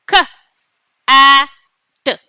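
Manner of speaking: K, A, T each said apart, with pauses; sounding out letters. Shown as a demonstration. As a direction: say K, A, T one letter at a time. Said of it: "Let's sound it out".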